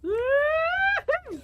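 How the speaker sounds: high loud whoop; whooping cheer. Real, not acted. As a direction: whoop in triumph. Celebrating a kill.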